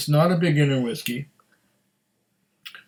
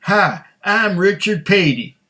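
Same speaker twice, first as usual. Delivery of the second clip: slow, higher celebrity accent impression; Richard Petty accent; 4 semitones higher than usual. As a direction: stretch the vowels in a race driver's accent. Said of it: "that's the way Richard Petty would talk".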